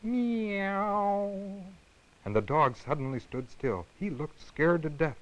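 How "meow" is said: high drawn-out kitten mew; frightened kitten crying for mother; kitten meow. Acted. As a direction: call out like a scared kitten. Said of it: "called for his mother".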